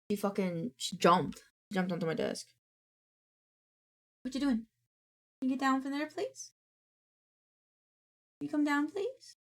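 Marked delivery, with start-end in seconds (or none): 4.2-9.4 higher coaxing voice for the cat, please rising up high; pet talk. Real, not acted. Streamer coaxing her cat down from the desk, high pet voice. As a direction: sweetly ask the cat to get down.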